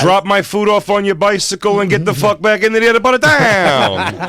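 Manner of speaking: high, loud hammed-up local's rant; mock angry yell. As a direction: bellow it, dragging out the last word. Acts a hostile local yelling.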